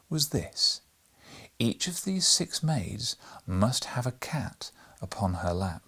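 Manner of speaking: ordinary delivery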